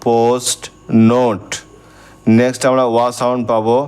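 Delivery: ordinary delivery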